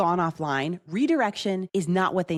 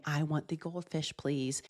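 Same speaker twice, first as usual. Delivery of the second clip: softer, gentle polite request; soft polite ask; 2 semitones lower, 8 dB quieter than usual. Shown as a demonstration. Parent models how the toddler should ask, softly. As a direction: model asking nicely and softly. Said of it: "Instead saying".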